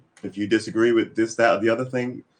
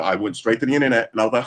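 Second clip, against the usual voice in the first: normal talking voice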